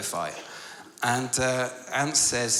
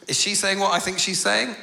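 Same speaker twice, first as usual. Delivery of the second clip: high, loud, scandalised question; incredulous reader; 8 semitones higher, 6 dB louder than usual. Acted. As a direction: act out disbelief at what you just read. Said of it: "bits where you're thinking".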